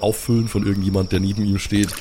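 normal talking voice

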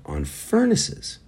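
high, slow, disbelieving on furnaces; high, drawn-out disbelief; incredulous. Real, not acted. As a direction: stretch it up in disbelief.

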